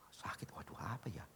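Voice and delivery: breathy whisper of pain; preacher reliving chest pain in a whisper. Retold or imitated. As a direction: hush it as if clutching your chest. Retelling a sudden chest pain.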